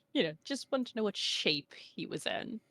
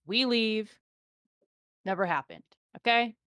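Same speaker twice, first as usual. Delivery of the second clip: halfling voice; 5 semitones higher, 4 dB louder than usual. Acted. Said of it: "This is the halfling talking".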